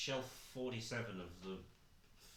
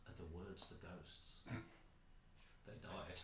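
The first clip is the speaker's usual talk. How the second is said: spooky hushed; 4 semitones lower, 13 dB quieter, slower than usual. Acted. Spooky joke.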